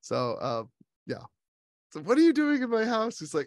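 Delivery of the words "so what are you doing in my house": high, loud mock challenge; startled homeowner. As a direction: confront the intruder in a raised pitch. Acts out the confronting homeowner.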